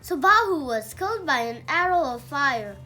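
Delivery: plain reading voice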